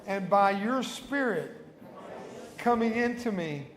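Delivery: slow, measured phrases with long pauses; solemn sinner's prayer, spoken clearly; led prayer. Real, not acted. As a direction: give each line slowly so the church can repeat it. Leads congregation in repeat-after-me prayer.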